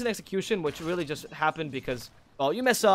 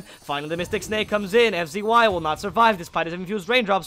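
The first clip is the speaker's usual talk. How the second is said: loud, higher-pitched esports commentary; excited play-by-play; 4 semitones higher, 8 dB louder than usual. Real, not acted. Caster calling a teleport escape attempt, excited play-by-play. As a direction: build steadily as the escape unfolds.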